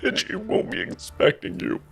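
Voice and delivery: high, breathy Kronk whisper. Acted. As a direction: hush it, high and goofy. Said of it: "good Kronk impression".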